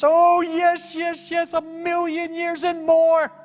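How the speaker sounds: very high, squealing gleeful voice, drawn out at the end; jubilant angel. Retold or imitated. Angel telling pastor its age, exuberant. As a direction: crow it ecstatically. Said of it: "The angel was just laughing like crazy".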